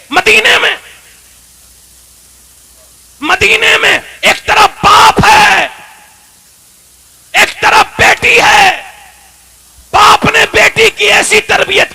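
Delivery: slow, high, shouted preaching; full-force sermon. Acted. Preacher extolling the Prophet and his daughter, slow shouted climax. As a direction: drag each word out at full volume.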